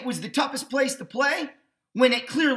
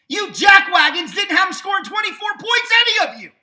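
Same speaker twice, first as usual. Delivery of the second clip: loud, high-pitched rant; raised-voice scolding, pitch jumping up; indignant outburst; 10 semitones higher, 8 dB louder than usual. Real, not acted. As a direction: shout it indignantly at the doubters. Indignant rant at doubting pundits.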